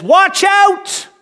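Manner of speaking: high, loud shouted warning; aggressive warning shout. Retold or imitated. Jesus warns the crowd, shouting loud and high. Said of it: "very aggressive with his words".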